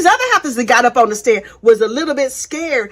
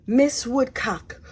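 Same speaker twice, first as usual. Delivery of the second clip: slow, soft whispered address; mock whisper; 6 dB quieter, much slower than usual. Lori timidly addressing Kay in cross-examination, whispered. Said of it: "so she was just like".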